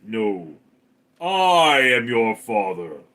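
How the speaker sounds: slow Darth Vader line. Retold or imitated. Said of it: "And Darth is like".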